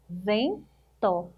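"Vento" as two parts, ven, pause, to; split into syllables. Shown as a demonstration. Teacher counting two syllables for pupils, "Vento" split apart. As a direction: break "Vento" after "Ven" with a clear gap. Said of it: "Duas sílabas também".

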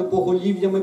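ordinary delivery

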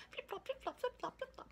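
high squeaky sing-song flip-flop; playful high-pitched sound effect. Acted. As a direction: pop the sound way up high like a tiny tail. Squirrel's tail sound effect.

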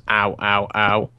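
lightly mimicked seal call; low, evenly repeated mock seal ows; seal imitation. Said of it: "'Cause the seal goes".

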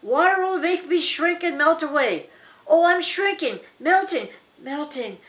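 high-pitched melodramatic wail, fading on the last word; wailing scream. Acted. Witch melts away under the water, wailing high and fading. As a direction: cry out high, then die away. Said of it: "screamed the evil woman".

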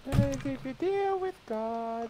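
silly high voice. Pretend. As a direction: stretch it out in a goofy voice. Friend jokes in put-on voice.

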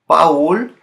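Paul said the Spanish way, as "pa-ool" with two vowels; Spanish vowel split pronunciation; Spanish Raúl. Shown as a demonstration. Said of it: "In English, his name is Paul, but in Spanish is Paul".

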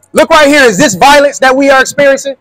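usual speaking voice

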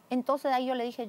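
normal talking voice

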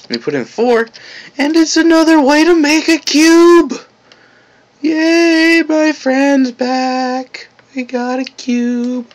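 very high, loud squealing joy; excited. Real, not acted. Delighted at cube appearing.

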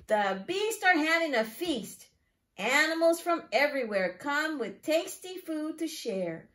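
lively, singsong picture-book reading; reading to children. Acted. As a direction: read it to a class of small children. Teacher reads picture book aloud.

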